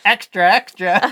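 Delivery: high, slow newsboy cry; newsboy call. Pretend. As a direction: shout it like an old-time paperboy. Newsboy call in reporter bit.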